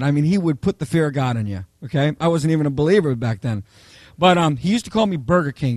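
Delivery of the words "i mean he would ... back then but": ordinary talk, nothing marked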